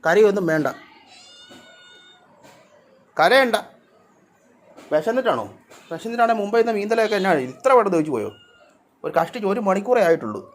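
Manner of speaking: high, sing-song pet talk with a laugh; fond, high teasing to a hungry cat; cooing at the cat. Real, not acted. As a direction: coax the crying cat in a lifted voice. Soothing the cat.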